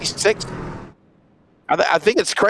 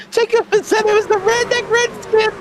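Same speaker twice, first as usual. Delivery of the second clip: laughing. Real, not acted. Jokes while laughing.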